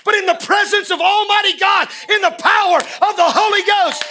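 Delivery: high, loud, sustained preacher's cry; full-force preaching shout. Real, not acted. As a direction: preach it at the top of your voice.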